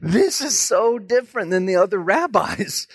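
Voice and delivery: high, stretched-out astonished cry; amazed congregation. Acted. Churchgoers amazed at the new rabbi, high astonished voice. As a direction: marvel loudly in a high put-on voice. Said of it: "everybody in church, they just go".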